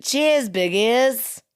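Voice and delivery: slow, loud Australian cheers; mock Australian sign-off; Australian accent. Was that not Australian accent.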